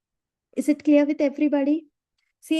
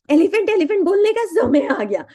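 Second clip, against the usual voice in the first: higher and louder, driving "elephant elephant" home; louder repeat; 5 semitones higher, 7 dB louder than usual. Shown as a demonstration. Coach urging the class, loud and emphatic. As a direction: say it again with more force. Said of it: "No, elephant elephant बोलने का समय आ गया".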